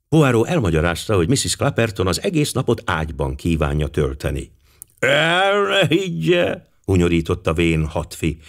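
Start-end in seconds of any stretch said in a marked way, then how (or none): 5.0-6.7 high, slow, put-on old man's voice; scoffing old general. A narrator's reading. Old general to Poirot about the wife staying in bed, scoffing. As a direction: draw it out high and knowing.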